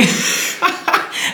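high breathy laugh breaking through. Real, not acted. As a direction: crack up mid-scene. Breaks up mid-performance.

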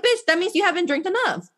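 put-on girl teasing a sober friend in Vegas, playful nagging; teasing quote. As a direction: tease her friend into partying.

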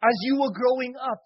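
fervent, loud sermon delivery; preaching. Acted. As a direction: preach it out with full force. Preaching to the audience.

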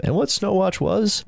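usual speaking voice

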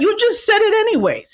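shoots way up high in disbelief; incredulous, as if you can't believe it; exasperated. Real, not acted. Ranting to viewers about a public gaffe, high disbelieving outburst. Exasperated at someone's careless remark.

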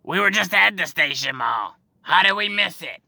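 mocking excited-woman voice. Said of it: "and she goes".

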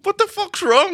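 worried mum. Retold or imitated. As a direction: jump way up into the frantic mum's voice.

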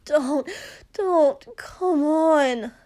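loud, high pleading cry; desperate plea. Acted. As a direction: beg with the voice cracking. Desperate plea in intimate scene.

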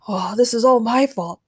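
sighing, mildly mournful admission; sad. A narrator's reading. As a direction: admit blame with regret.

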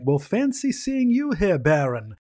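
high, slow, put-on toff voice; Laszlo's posh greeting. Laszlo greeting the dug-up Baron, high slow toff voice. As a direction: greet him with mock grandeur.

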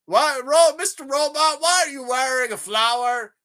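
mock-bewildered demand to an underling; imitated villain boss. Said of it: "he's like, he's like".

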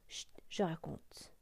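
slow whispered shush; hushed whisper. Real, not acted. Storyteller hushes the children before the tale, whispering. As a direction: whisper it to hush the listeners.

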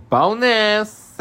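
high, loud drawn-out exclamation; gleeful crowing. Retold or imitated. Recapper voices Tracy finding a video diary, loud mock glee. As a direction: crow it like a juicy discovery.

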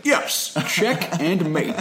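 high, slow nerd voice; put-on squeaky nerd; imitated cartoon nerd. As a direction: draw out a high-pitched nerdy victory line. Acts out smug nerd's retort.

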